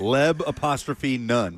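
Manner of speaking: Leb, then apostrophe, then none, slowly; slow, deliberate "Leb apostrophe none"; local pronunciation spelled out. Shown as a demonstration. Demonstrating local Lebanon pronunciation.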